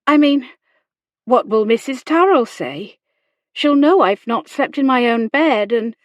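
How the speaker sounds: high, loud, flustered objection; faltering. A narrator's reading. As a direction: grasp anxiously for an excuse. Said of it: "She faltered".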